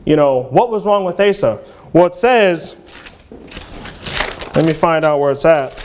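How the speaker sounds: ordinary delivery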